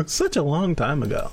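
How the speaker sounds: said through laughter; laughing. Real, not acted. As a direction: laugh as you say it, amused.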